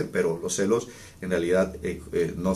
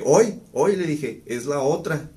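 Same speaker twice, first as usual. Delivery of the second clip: teasing joke; 7 semitones higher, 3 dB louder than usual. Retold or imitated. Joking as message arrives.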